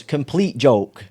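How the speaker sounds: slow, drawn-out repeat; dad's slow joke. Retold or imitated. Dad teasing his daughter, slow drawn-out words. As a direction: stretch each word out like a punchline. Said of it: "And then he goes".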